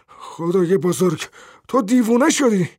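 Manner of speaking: high, loud, appalled cry; horrified shout. A narrator's reading. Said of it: "با وحشت فریاد کشید".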